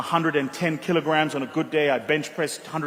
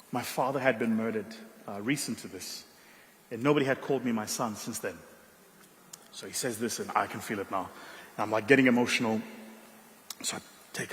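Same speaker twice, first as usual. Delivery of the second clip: real emotion; 5 semitones lower, 6 dB quieter than usual. Real, not acted. Recalling father's murder, getting emotional.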